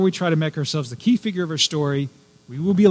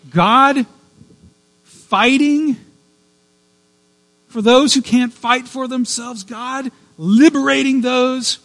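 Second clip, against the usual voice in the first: higher, louder, impassioned proclamation; passionate preaching; 7 semitones higher, 5 dB louder than usual. Real, not acted. Preacher to congregation, impassioned and higher. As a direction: lift it up with rising fervor.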